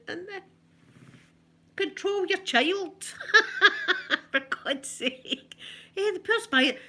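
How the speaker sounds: words squeezed out through high laughter; laughing. Real, not acted. Laughing at the boy's antics.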